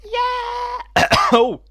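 shrieking yeah, very high and loud; excited yell. Real, not acted. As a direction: squeal it out. Sudden excited yell then cough.

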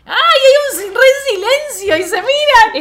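high, loud gushing squeal; overexcited fan shriek at a movie moment; excited squeal. Real, not acted. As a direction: squeal it out in delight. Excited about the silent stare.

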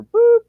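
high, loud electronic boop; phone beep. Retold or imitated. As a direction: mimic the phone's cut-off beep. Phone line beeps, imitated.

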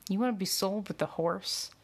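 ordinary delivery, nothing marked

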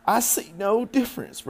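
put-on child's indignant high voice; defiant Fern. A narrator's reading. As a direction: dig in as a stubborn daughter. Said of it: "replied Fern".

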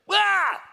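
loud, high-pitched shriek of fright; startled scream. Acted. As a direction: yelp as if someone burst through the wall. Jesus bursts through wall, disciples startle.

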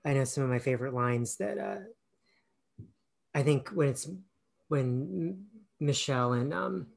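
normal talking voice

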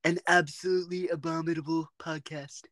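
put-on presidential impression; Donald Trump. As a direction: declare it in a Trump-style voice. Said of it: "like, a Donald Trump voice".

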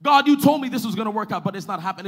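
loud, high, hurried protest; frustrated complaint. Acted. Preacher voicing believer complaining to God, loud hurried frustrated protest. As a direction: act out exasperation with God. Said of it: "we're frustrated".

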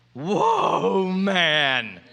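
long drawn-out, amazed "whoa"; awestruck Adam. Acted. Adam seeing the woman for the first time, awestruck. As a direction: stretch both words out in wonder.

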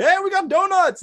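high, loud breezy hey; cheery announcement. Retold or imitated. Walking back into class with donuts, cheery.

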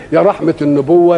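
ordinary talk, nothing marked

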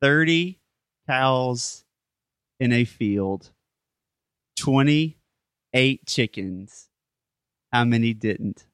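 riddle read out slowly with long pauses; measured riddle delivery, pausing between chunks; slow, deliberate repeat. Shown as a demonstration. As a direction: spell it out phrase by phrase.

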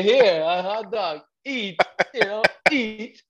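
high, loud, hammed-up coaxing voice; coach offering food. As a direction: playfully mimic someone pushing food on you.

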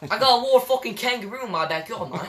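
mock Australian bloke bragging; Australian accent. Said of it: "I can do Aussie, too".

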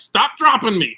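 mock panicked yell. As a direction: yell it as if falling. Retelling his yell on the drop.

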